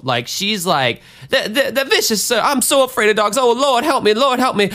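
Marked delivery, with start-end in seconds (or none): 1.3-4.7 put-on high, panicky old lady pleading; freaking-out woman impression. Scared woman on the porch pleading to the Lord, high frantic impression. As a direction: imitate a scared old woman wailing for help. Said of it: "this lady is freaking the fuck out".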